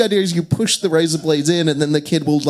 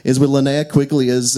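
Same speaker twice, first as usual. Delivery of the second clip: normal talking voice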